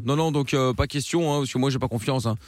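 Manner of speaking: put-on accent du Sud, voix plus grave; southern French accent. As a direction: play a cocky guy from the Sud with a thick southern French accent. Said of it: "avec ton accent, là, à la con".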